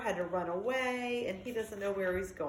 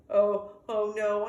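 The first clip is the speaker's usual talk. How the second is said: dawning alarm; 6 dB louder, slower than usual. Acted. Dog realises bird trap.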